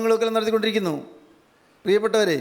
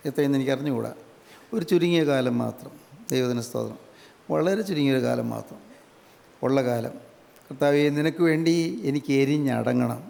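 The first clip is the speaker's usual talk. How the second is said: prayer; 6 semitones lower than usual. Acted. Preacher prays aloud.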